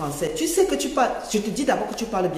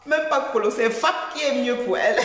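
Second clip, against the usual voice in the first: usual speaking voice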